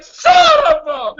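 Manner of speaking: high, loud, stretched-out curse; hammed-up exasperated yell; mock furious cursing. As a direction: drag out the outraged cry. Repeats reaction to the spoiler.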